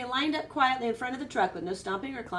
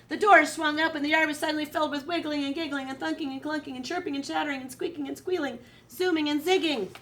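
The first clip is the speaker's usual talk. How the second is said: fast, higher lively rush; excited rush; 7 semitones higher, 4 dB louder, faster than usual. A narrator's reading. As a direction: tumble through the list. Recess bell, chaos bursts out.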